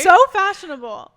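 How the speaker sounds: loud high gush; excited. Real, not acted. Echoing friend, loud and high.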